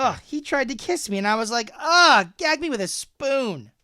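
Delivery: high, hammed-up Valley Girl; exaggerated grossed-out teen slang voice; Valley Girl disgust. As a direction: burst out with a loud "ugh", then drag out the line. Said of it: "That's how they would say it".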